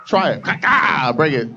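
shouting a hammed-up dare; mock tough shout. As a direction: bark it like a prison hardass. Aggressive mock tough-guy bit.

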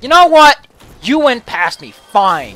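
loud, mock-indignant dragon voice; mock huffy dragon. Player voices the dragon he got past, in a mock huff. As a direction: give up loudly in a playful huff. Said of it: "He's like".

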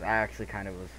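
ordinary talk, nothing marked

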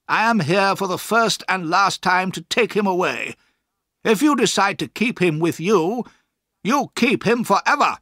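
stern final warning; ultimatum. A narrator's reading. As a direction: deliver the threat emphatically, building to the end. Murdstone gives an ultimatum.

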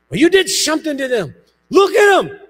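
loud, high accusing shout; upset daughter accusing the pastor, loud; angry daughter. Retold or imitated. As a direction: throw the blame at him.

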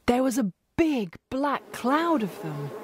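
s'exclame avec un étonnement marqué; exclaimed. A narrator's reading. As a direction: rejoue le souvenir, tout étonné. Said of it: "exclaimed Mister Marlowe".

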